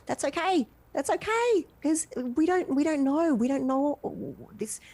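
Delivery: heartfelt high reassurance; emotional plea. Real, not acted. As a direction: plead gently, voice lifted high. Guest pleads emotionally.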